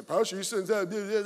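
imitating a whiny church member; lightly hammed-up griping; mock whiny complaint. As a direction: whine it mockingly at the pastor. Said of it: "it's so whiny".

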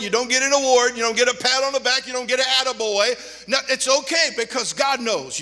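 loud, driving preacher cadence; full-force preaching. Acted. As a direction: hammer it home from the pulpit.

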